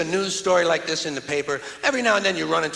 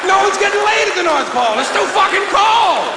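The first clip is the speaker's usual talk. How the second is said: higher, louder bellow; punchline shout; 11 semitones higher, 7 dB louder than usual. Real, not acted. Comic hammering home the North Pole punchline, yelling. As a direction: blast the punchline.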